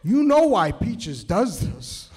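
firm fatherly correction, slowing down; father lecturing his squeamish son; stern dad. Retold or imitated. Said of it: "he goes".

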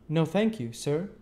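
a hint of a polite young boy; young Pip. A narrator's reading. Young Pip answers the stranger.

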